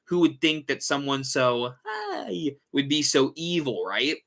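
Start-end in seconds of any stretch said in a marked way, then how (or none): 1.8-2.5 much higher sing-song "Hi"; innocent clown hello. Acted. Showing how innocent a clown seems, high sweet greeting. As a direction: chirp it sweet and harmless. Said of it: "innocent and so creepy".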